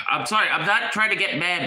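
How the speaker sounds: ordinary delivery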